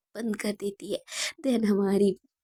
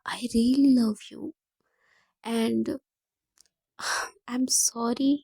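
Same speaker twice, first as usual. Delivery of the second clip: slow loving whisper; much slower than usual. A narrator's reading. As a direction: whisper it tenderly into her ear. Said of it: "बहुत प्यार से बोलते हुए".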